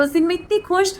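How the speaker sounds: normal talking voice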